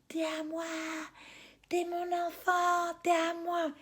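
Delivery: put-on high, slow, drawn-out old woman's voice; mock old woman yelling. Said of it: "elle me crie dessus". (vieille dame qui crie, voix aiguë et lente)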